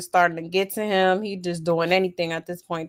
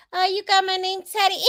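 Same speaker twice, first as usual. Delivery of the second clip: high, laughing mock girlfriend voice; mimicking her giggle; 11 semitones higher than usual. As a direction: squeal it through a giggle, mocking her. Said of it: "And she just laughing".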